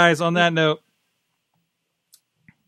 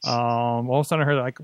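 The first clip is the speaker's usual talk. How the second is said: usual speaking voice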